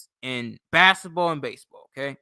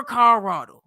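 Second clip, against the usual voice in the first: mocking scorn; 7 semitones higher, 9 dB louder than usual. Real, not acted. Mocking a Denver commenter.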